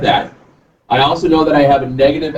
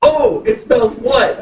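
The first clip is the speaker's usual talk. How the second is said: son realizing; 8 semitones higher, slower than usual. Retold or imitated. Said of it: "He goes".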